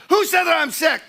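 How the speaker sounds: high, loud, offended retort; indignant outburst. Retold or imitated. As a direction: bristle and shout the question back. Repeats the indignant denial.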